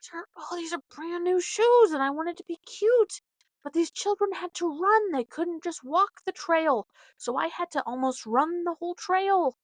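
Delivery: hammed-up grumble about the kids; mock angry rant. Mom griping about new shoes ruined on trail, mock exasperated. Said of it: "I was so mad".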